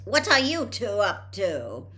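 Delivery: a mother catching her sons scheming; sharply. A narrator's reading. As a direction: pin the twins down with a suspicious snap. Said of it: "said Missus Weasley sharply".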